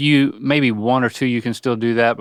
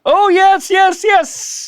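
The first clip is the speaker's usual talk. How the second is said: high, loud thrilled yes; triumphant squeals of yes; excited cheering; 6 dB louder, much slower than usual. Real, not acted. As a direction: cheer him on with delight. Celebrating a correct answer.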